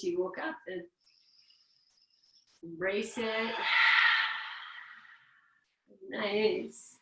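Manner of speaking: calm, unhurried instructor voice leading breaths; guided breathing. Acted. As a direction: guide the class gently through the yawn.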